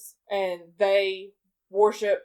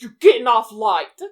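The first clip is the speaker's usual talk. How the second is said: raised-voice movie quote; retold loud rebuke; 8 dB louder than usual. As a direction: call it out like a mock scolding. Acting Bartleby's rebuke of Loki.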